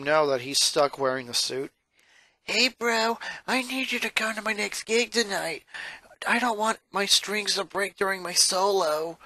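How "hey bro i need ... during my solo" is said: lightly voiced rocker sister, a touch higher; casual upbeat rocker girl; Luna voice. Rocker sister asking her brother to a gig, casual.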